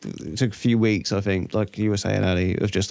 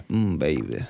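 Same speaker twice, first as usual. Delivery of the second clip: seductive; 9 semitones lower, 3 dB quieter, slower than usual. Shown as a demonstration. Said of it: "Can we get, like, a".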